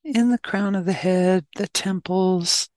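calm, unhurried meditation guidance; short, even phrases with long silences between; guided meditation. Real, not acted.

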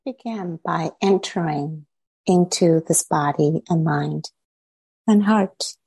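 unhurried meditation guidance with long pauses between phrases; measured phrases set apart by spacious silences; guided meditation. Real, not acted. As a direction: lead them inward, leaving silence after each short phrase.

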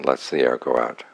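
ordinary talk

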